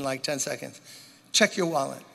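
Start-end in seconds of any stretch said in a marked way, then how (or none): none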